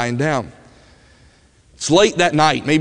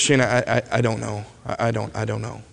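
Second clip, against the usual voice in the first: softly voiced tearful boy, fading quieter; a touch of tears, words breaking off, growing softer; crying; 5 semitones lower, 7 dB quieter than usual. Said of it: "and he starts crying".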